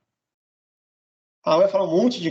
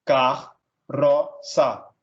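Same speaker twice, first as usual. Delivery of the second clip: "car", "ro", "sa" as three separate beats; split into syllables; 2 semitones lower, much slower than usual. Shown as a demonstration. Teacher dividing carroça for students, "car, ro, sa" syllable by syllable. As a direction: pause after each of "car", "ro", "sa". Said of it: "separar um em cada sílaba".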